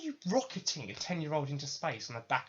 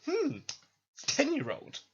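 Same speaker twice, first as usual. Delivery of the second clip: higher, pompous musing; sizing up the kid grandly; mock champion; 13 semitones higher, 4 dB louder, slower than usual. Champion Steven eyeing the ten-year-old, pompous. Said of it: "and goes".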